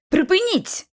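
high-pitched, mild cry to stop; exclaimed order. A narrator's reading. Lucy tries to stop the boys fighting, exclaimed order. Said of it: "вигукнула я".

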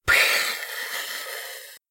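soft breathy cat hiss. A narrator's reading. Cat disgusted by the bed, soft hiss. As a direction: spit out a tiny hiss. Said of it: "Снова зашипела".

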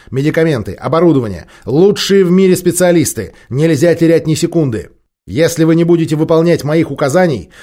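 loud, high, urgent commands; shouting orders. Acted.

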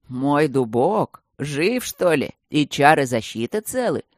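light up at news of his oak; delighted. A narrator's reading. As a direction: dragon delighted his old oak still lives. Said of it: "обрадовался дракон".

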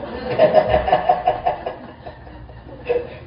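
a long, rolling laugh. Real, not acted. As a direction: laugh it out, amused. Laughs at the audience joke.

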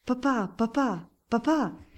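put-on children crying out to their father; shrieking kids. A narrator's reading. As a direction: squeal it like little eaglets. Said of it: "strillano i quattro ragazzi".

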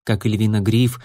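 usual speaking voice

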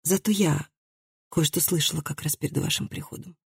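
soft, timid near-whisper, fading. A narrator's reading. Daughter dares to speak up before the visitors, whispering. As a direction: venture it very softly, almost whispering. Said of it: "прошептала Хильда".

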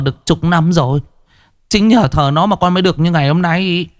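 high, fast, stumbling frightened pleading; a scared man tripping over his words, pitched high; stammering in fear. A narrator's reading. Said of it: "lắp ba lắp bắp".

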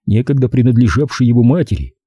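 plain reading voice

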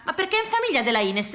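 ordinary delivery

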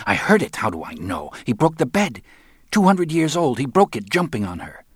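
high-pitched, agitated protest; indignant sister insisting on what happened; heated outrage. A narrator's reading. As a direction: argue it hotly, pitch leaping up. Marta angrily argues with Jesus.

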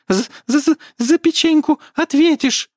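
high, loud, stammering threat; furious stammering elf. A narrator's reading.

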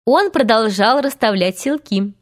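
plain reading voice

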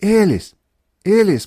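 two high, loud cries; calling out. A narrator's reading. Мэдалин calling her sister, loud alarmed cry. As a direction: call the name in alarm. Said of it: "послышался голос Мэдалин".

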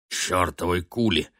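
grumbling through a mouthful; mouth full. Acted. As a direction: mutter it with food in mouth. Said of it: "с набитым ртом пробурчал капитан".

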